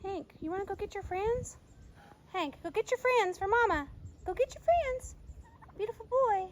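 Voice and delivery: high, soft cooing to an animal; coaxing pet talk. Real, not acted. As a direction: sweet-talk him softly in a high voice.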